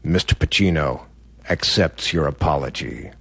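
a lightly voiced, condescending handler; aloof friend. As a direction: speak for the star like a haughty gatekeeper. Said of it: "His friend says to me".

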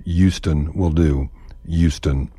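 "Houston" as "Youston", slow; Houston without h. Shown as a demonstration. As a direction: drop the h: "Youston". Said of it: "doesn't require the H in Houston".

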